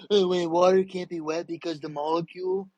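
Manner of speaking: dopey put-on voice for a know-it-all; mocked objector. Imitating people who argue water isn't wet, mocking. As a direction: mockingly parrot a pedant in a silly voice. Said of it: "People go".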